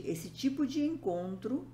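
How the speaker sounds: ordinary talk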